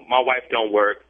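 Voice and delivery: ordinary talk, nothing marked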